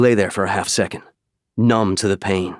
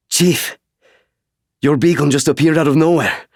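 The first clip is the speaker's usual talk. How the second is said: higher and louder pilot calling over the radio, with a relieved sigh in the middle; pilot on comms; 6 semitones higher, 4 dB louder than usual. Acted. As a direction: call out over the comm, relieved to find him.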